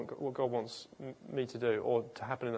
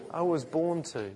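like you mean it; 3 semitones higher than usual. Shown as a demonstration. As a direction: declare it with conviction, stressing BORN. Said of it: "Say it like you mean it".

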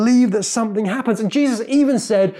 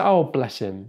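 mildly pitying, lower aside; patronizing; 7 semitones lower, slower than usual. Acted. Someone's a bit rubbish, patronizing. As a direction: offer limp, condescending sympathy. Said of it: "pathetic and powerless and patronizing".

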